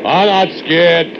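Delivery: high, slow defiant holler; shouted retort. Acted. Worker answering the foreman over foundry noise, high shouted retort. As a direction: holler it back over the machinery.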